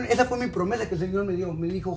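usual speaking voice

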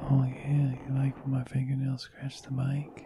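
breathy ASMR whisper. Pretend. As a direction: whisper close to the mic.

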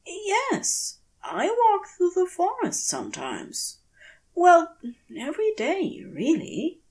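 an unseen creature answering with amusement; amused forest voice. A narrator's reading. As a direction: tease him lightly from the branches. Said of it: "a smoky purr of flitting amusement".

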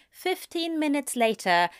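ordinary delivery, nothing marked

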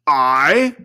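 loud, startled ghost cry; an unearthly, indignant "I?"; cried out. Acted. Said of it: "cried the spirit".

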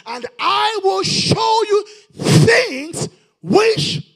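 high, loud, slow shouted promise; booming scripture quote. Acted. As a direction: proclaim it like God speaking from heaven. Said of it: "The Bible says".

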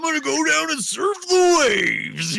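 high, loud, stretched-out wrestler roar; Randy Macho Man Savage. Pretend. Host turns surfer talk into wrestler parody, hammed-up roar. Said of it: "fucking rock Randy Macho Man".

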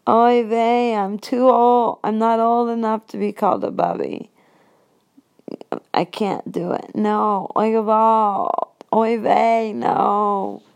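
lightly voiced old Yiddish grandma; Yiddish accent.

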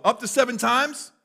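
high, loud, self-satisfied question; eager boast rising at the end; proud of himself. Acted. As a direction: offer it up proudly, pitched high. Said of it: "he's so proud of himself".

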